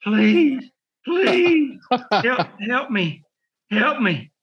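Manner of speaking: high, drawn-out plea for help; hammed-up pleading, pitch leaping on "please"; mock begging. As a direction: imitate a worn-out opponent begging for mercy. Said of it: "he would start begging me".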